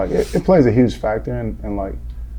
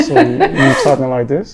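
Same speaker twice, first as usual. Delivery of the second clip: talking through clenched teeth, laughing; through gritted teeth; 8 semitones higher, 4 dB louder than usual. Shown as a demonstration. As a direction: ventriloquist style, lips barely moving. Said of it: "Like a ventriloquist".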